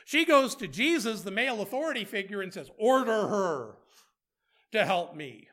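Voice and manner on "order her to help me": slow, louder, insistent mock order; bossy demand. Martha demanding Jesus make her sister help, bossy and slow. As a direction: press each word like a bossy sister.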